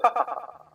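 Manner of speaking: mock smug ha ha ha, high; a smirking merchant's chuckle; hammed-up smug chuckle; smirking laugh. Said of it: "Kind of smirks a little bit and goes".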